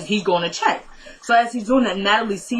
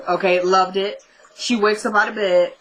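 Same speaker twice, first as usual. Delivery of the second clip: usual speaking voice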